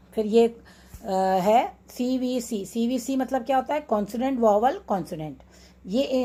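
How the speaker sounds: ordinary talk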